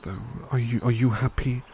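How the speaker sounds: ordinary talk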